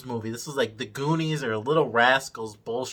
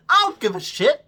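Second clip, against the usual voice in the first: exasperated outburst; 11 semitones higher, 6 dB louder than usual. Real, not acted. Ranting about a dead character.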